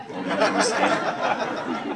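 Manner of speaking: breathy laughing whisper, high. Real, not acted. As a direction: laugh the words out under breath. Shy laugh ending his answer.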